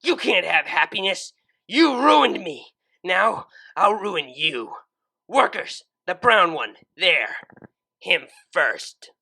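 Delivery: furious yell. Acted. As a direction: yell orders with fury. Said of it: "yelled with more fury".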